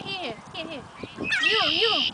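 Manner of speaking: normal talking voice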